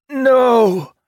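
high, loud cry of despair; anguished cry. Acted. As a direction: cry out as the shield breaks. Barrier shatters, cry of despair.

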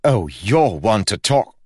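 scoffing. Acted. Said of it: "Lila scoffed".

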